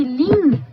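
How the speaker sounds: "lin" slowly, with a nasalized i and no separate n; nasal lin demo. Shown as a demonstration. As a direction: stretch out "lin" as a nasal vowel. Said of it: "olha o que que eu tô escutando".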